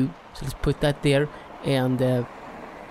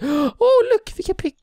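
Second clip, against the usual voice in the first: normal talking voice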